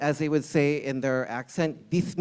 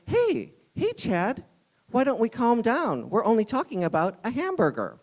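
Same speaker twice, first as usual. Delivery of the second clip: a hint of a higher, soothing peacemaker voice; mock calming bystander; 7 semitones higher than usual. Bystander talking the rude customer down, mock soothing. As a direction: lightly play the bystander talking Chad down.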